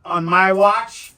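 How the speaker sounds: high, slow, drawn-out heroic shout; hammed-up shout, laughter breaking out after; re-enacted yell. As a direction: stretch it out as a triumphant yelled punchline. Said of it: "and I yelled at him".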